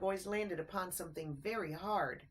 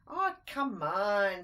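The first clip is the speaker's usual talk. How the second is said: drawn-out slow coaxing aw; 7 dB louder, much slower than usual. A narrator's reading. Little mouse coaxing his friend, slow and drawn out. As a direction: coax him along lazily.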